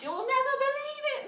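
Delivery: high, loud, fast and thrilled; excited Jack. Acted. Jack bursting in to tell mum, high and fast. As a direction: burst out with the news. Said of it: "said Jack".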